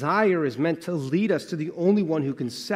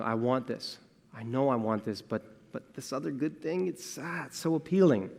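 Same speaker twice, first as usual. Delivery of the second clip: much softer, hesitant inner voice; wavering, tempted; 6 dB quieter than usual. Pretend. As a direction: talk yourself quietly toward temptation, stumbling on words. Voicing someone wavering before temptation.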